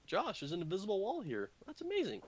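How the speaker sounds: high-pitched mock amazement; sarcastic. Real, not acted. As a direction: feign being impressed, pitch lifted high. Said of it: "That was me sarcastic".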